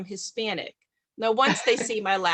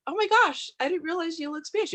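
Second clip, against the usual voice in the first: much higher, gushing surprise; mock amazed gasp; 9 semitones higher than usual. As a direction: play the stunned acquaintance, high and breathless. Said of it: "they're like".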